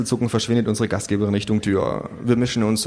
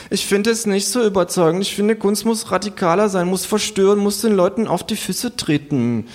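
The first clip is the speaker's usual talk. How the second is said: higher, lightly voiced pretentious young man; earnest young artist; 9 semitones higher than usual. A narrator's reading. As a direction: preach radical art. (leicht gespielter, prätentiöser junger Mann)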